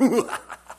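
short high burst of laughter; mock laugh. Preacher imitating disciples laughing about Judas. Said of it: "He laughed".

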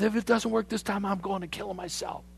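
high, exasperated; fed up, half joking; exasperated threat. Retold or imitated. Naaman's frustrated threat.

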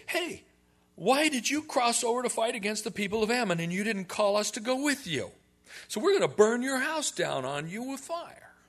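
high, loud mock threat from the Ephraimites; hammed-up bully tribe shouting their grievance; mock angry mob. Ephraim men threatening Jephthah, loud and high mock anger.

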